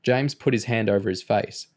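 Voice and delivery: usual speaking voice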